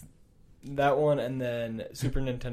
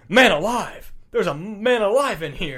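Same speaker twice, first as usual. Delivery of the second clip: excited shout; 7 semitones higher, 5 dB louder than usual. Retold or imitated. Quote acted.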